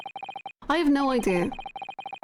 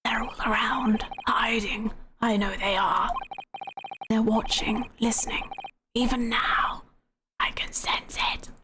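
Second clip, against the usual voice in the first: hushed and lower, paranoid prisoner; creepy paranoid murmur; eerie whisper; 4 semitones lower, 3 dB quieter than usual. Acted. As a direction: whisper like someone is listening. Paranoid prisoner voiced in game.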